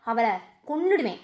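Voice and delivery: low, soft menacing threat; mother's low menacing warning; quiet threat. Acted. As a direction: drop the voice and threaten. Mother threatens to kill sister.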